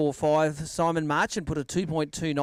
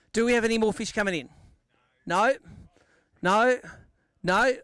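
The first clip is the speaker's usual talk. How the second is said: calling out; 4 semitones higher than usual. Real, not acted. Host calling to the crowd for late fish, raised voice. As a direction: call out to the crowd, a bit raised.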